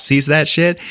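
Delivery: ordinary talk, nothing marked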